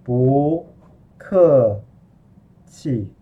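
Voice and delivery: syllable by syllable, long pauses between; slow-motion pronunciation demo for learners. Shown as a demonstration. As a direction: stretch out each tone separately. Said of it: "I can say it very slow".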